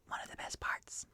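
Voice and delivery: very soft whispered aside; sneaky whisper. Acted. Rogue to the party before picking pockets, whispering. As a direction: whisper it like a rogue about to loot.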